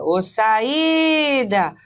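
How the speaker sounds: "saída" with the i held long, high and loud; hiatus in saída. Shown as a demonstration. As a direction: stretch the lone i as sa-III-da in "saída". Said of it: "achei um I sozinho na sílaba tônica".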